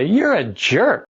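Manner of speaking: blunt insult. Pretend. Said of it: "you stand up and you say".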